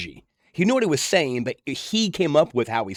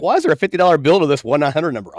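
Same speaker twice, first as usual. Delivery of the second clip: mom scolding; 3 semitones higher, 7 dB louder than usual. Retold or imitated. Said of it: "My mom's like".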